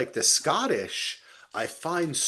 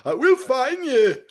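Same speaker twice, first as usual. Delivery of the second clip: high, loud put-on voice; playful high, loud menace; mock movie threat; 13 semitones higher, 7 dB louder than usual. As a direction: ham up a thriller threat. Quoting a thriller line.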